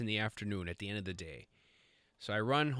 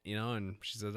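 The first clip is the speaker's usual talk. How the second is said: normal talking voice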